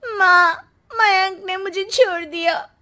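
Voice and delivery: high, loud tearful wailing; crying. Acted. Abandoned daughter cries to her parents, begging forgiveness. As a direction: sob out the apology, voice breaking. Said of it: "आ जाती है रोते हुए".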